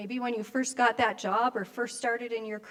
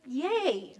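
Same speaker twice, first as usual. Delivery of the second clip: little cheer; 4 semitones higher than usual. Retold or imitated. Cheering the grandson walking.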